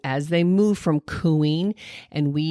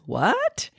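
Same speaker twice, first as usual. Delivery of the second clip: "what?" sharply rising high; rising question; 10 semitones higher than usual. Shown as a demonstration. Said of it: "When I raise my voice at the end".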